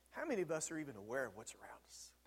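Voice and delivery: breathy whisper of an inner thought, quick and soft. Acted. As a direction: breathe it as if thinking to yourself. Inner thought, then repeated aloud.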